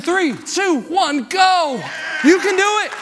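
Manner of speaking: high, emphatic count into a heave; rallying countdown. Real, not acted. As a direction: call the lift, rising to a cheer. Counting down to a joint lift.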